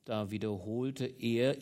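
ordinary delivery

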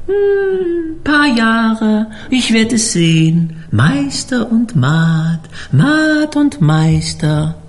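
high, slow, eerie prophecy; drawn-out, high cook's voice, foretelling. A narrator's reading. Koch prophezeit, langsam. (hoch und langsam, wie ein Seher)

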